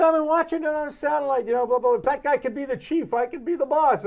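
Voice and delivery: high, loud put-on boss voice; imitating Steinbrenner. Steinbrenner voiced in phone story.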